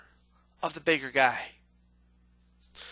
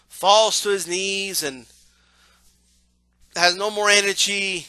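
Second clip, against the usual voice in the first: normal talking voice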